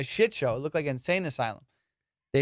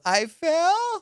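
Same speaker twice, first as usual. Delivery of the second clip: very high, stretched question; incredulous; 14 semitones higher than usual. Retold or imitated. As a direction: imitate her squeaky-high disbelief. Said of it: "With this tone".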